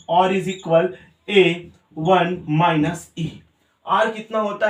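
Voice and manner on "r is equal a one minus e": slow dictation. Shown as a demonstration. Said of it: "teacher dictates the formula".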